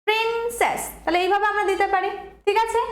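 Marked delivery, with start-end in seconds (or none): 0.1-0.8 princess with প্রিন held, সেস falling away; stress on first syllable. Shown as a demonstration. Teacher recapping the rule for students, princess stressed on প্রিন. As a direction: lean hard on প্রিন in princess. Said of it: "জোরটা বেশি পড়লো".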